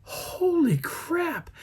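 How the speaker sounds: very high, slow, breathy-sounding disbelief; stunned. Retold or imitated. Storyteller reacting as the scream goes on, slow high disbelief. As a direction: squeak it out slowly, way up high. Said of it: "I was like".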